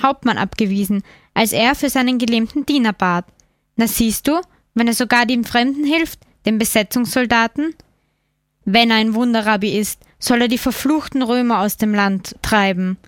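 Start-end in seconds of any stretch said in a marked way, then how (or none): none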